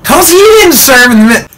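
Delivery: put-on high, loud kid voice blurting out the secret; imagined little brother yelling the truth. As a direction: squeal it out like a little brother spoiling everything. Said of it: "Billy is gonna be like".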